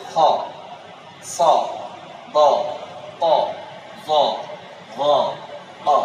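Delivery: খ, স, দ, ত, জ, ঘ, অ with a full heavy vowel near "aw"; isolated heavy Arabic letters খ, স, দ, ত, জ, ঘ, অ, vowel leaning to "aw". Shown as a demonstration. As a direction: round each letter toward অ, never a plain "aa", one at a time. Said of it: "এটা অয়ের কাছাকাছি হবে".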